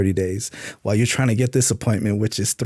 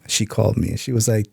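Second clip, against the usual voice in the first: ordinary talk, nothing marked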